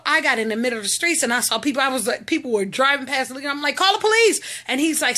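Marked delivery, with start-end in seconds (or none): 3.8-4.4 calling out. Retold or imitated. Retells calling out to passing drivers.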